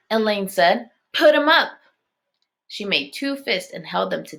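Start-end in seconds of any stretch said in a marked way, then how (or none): 1.1-1.7 challenge. A narrator's reading. Elaine challenging Daniel to fight.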